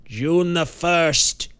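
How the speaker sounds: a shaken man crying out a dreaded date; exclaimed. A narrator's reading. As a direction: cry the date out in horror. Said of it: "exclaimed Banks in a hoarse whisper".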